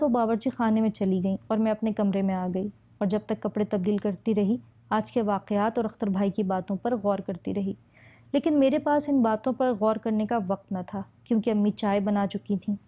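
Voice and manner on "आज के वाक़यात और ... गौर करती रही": plain reading voice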